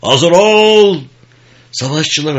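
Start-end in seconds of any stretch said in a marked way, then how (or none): 0.0-1.0 very high, loud drawn-out order; shouted command. Acted. Sergeant readying the riflemen, shouting at full voice. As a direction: bellow it across the ranks.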